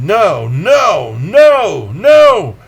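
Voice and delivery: furious, rising shout of no; a man refusing in a building fury; raging no. A narrator's reading. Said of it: "I went into a rage and said".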